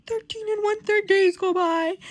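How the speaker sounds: high, loud mock outrage; hammed-up indignant outburst; retold irate. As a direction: act out someone irate at lost time. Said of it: "Peter goes irate at this shit".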